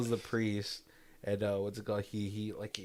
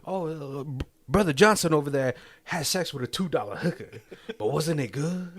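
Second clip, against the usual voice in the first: louder preacher voice calling out a sinner; mock preacher call-out; 5 semitones higher, 5 dB louder than usual. Priest calling out a churchgoer to the congregation, loud preaching. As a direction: preach it as a public shaming. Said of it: "calling out his practitioners".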